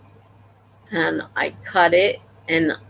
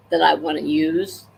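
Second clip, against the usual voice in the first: ordinary delivery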